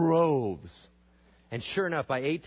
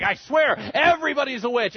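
very high, loud mock hysteria; freaking out; 11 semitones higher, 10 dB louder than usual. Colonists swearing the witches are everywhere, freaking out. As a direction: shriek it in hammed-up panic. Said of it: "they start freaking out".

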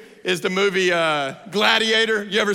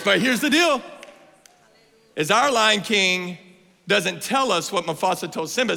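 usual speaking voice

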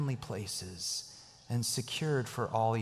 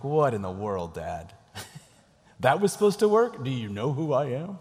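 mocking laugh; 4 dB louder than usual. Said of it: "his daughters just laughed at him".

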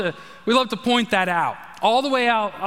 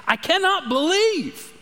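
awed wonder; 8 semitones higher than usual. Acted. Preacher voices John's wonder.

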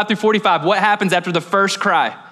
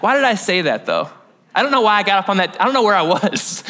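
ordinary delivery

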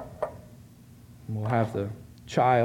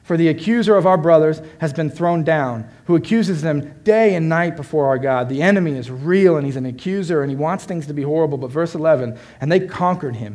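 loud, forceful scripture proclamation; preaching; 4 semitones higher, 8 dB louder than usual. Real, not acted. Preacher proclaiming victory over the accuser, preaching.

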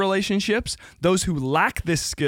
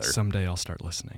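mock sulky mumble; 9 semitones lower, 6 dB quieter than usual. Mock grumble reply to teasing.

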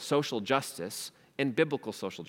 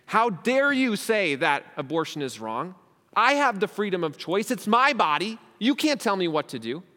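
raised, indignant voice, higher and louder; indignant objector; 6 semitones higher, 6 dB louder than usual. Retold or imitated. A critic confronting the preacher, indignant and raised. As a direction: protest like someone offended by his view. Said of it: "someone could look at me and say".

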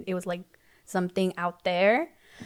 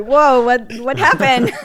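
louder, higher startled whoa; mock surprise; 4 semitones higher, 9 dB louder than usual. Wife marvels at nine years of marriage, mock surprised. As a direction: act caught off guard by the years.

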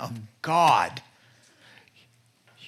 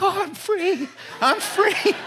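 much higher, giddy mock relief; relieved wife; 4 dB louder than usual. Wife escaping the kids as husband takes over, giddy high relief. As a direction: squeal it like a freed mom. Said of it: "your wife is like".

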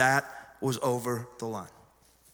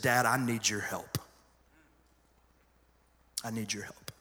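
quiet, shaky plea, fading softer on the repeat; trembling plea; 6 semitones lower, 5 dB quieter than usual. Retold or imitated. Son on the phone asking his dad for help, quiet and trembling. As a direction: hold back tears as you ask your dad for help. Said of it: "his voice was trembling".

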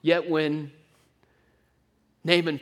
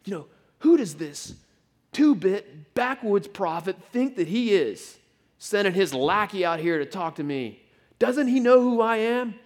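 high, loud offended outburst building to a shout; rising outrage of a snubbed general; pompous fury, peaking on "Doesn't he know who I am?"; indignant Naaman; 6 semitones higher, 6 dB louder than usual. Retold or imitated. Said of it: "Naaman might as well have said".

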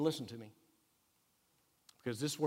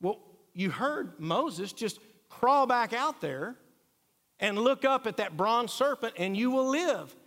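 urging wife; 6 semitones higher, 4 dB louder than usual. Retold or imitated. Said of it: "She said".

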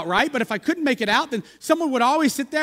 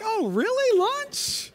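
fake surprise; 12 semitones higher, much slower than usual. Fake delight at lunch invitation.

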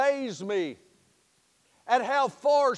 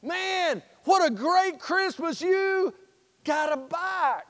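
put-on high, thrilled voice of a happy kid; pitched-up joyful kid; excited; 6 semitones higher than usual. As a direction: cheer for his brother's gift. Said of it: "Matter of fact, he was excited".

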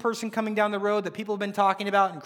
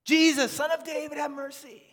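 high, insistent plea for mercy; high cry that tails off; 7 semitones higher than usual. Acted. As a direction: call out desperately to be heard. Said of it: "he cried out all the more".

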